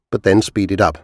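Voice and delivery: ordinary delivery, nothing marked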